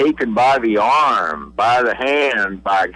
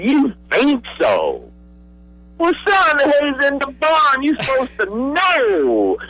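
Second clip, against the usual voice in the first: put-on much higher, slow stern old coach voice; imitating Coach Bryant. Head coach scolding his young quarterback, much higher and slower imitation. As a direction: put on the legend's voice and drag out the scolding. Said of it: "He said".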